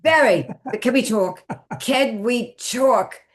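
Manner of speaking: loud, high brassy "Can we talk?" with a slow repeat; Joan Rivers impression. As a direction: channel Joan Rivers' brash comic bark. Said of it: "Joan has got one of the very distinctive voice".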